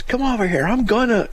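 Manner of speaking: high mock-threatening yell; imitated threat. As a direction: imitate a man shouting threats from a truck. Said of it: "he threatens me".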